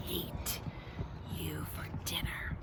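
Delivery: soft sinister whisper. A narrator's reading. Reader whispers the alligator's dinner, hushed. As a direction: whisper the bite like a threat.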